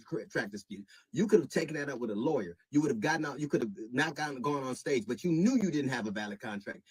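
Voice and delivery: ordinary talk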